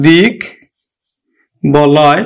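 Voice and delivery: দিক and বলয় set apart with pauses, ব fully sounded; syllable by syllable. Shown as a demonstration. Teacher sounding out দিক বলয় for students. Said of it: "সন্ধিজাত শব্দ".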